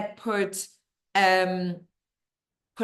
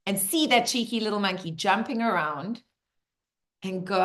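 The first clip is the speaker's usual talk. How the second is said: normal talking voice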